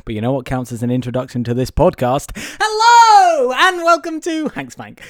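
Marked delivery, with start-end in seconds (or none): 2.6-5.0 high, loud show host; announcer voice. Acted. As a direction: belt out the welcome. Podcast intro announcement.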